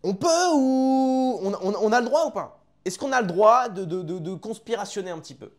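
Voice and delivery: high, loud provocative question; excited outburst. Real, not acted.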